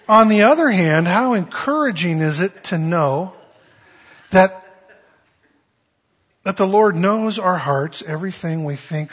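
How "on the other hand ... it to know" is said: usual speaking voice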